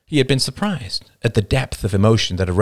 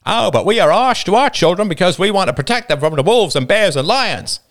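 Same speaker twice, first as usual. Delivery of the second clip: mocking Roman's odd accent, a touch higher; imitating Roman's odd accent; 10 semitones higher, 4 dB louder than usual. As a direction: parody Roman's strange accent with scorn. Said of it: "a possible imitation of Roman's odd accent".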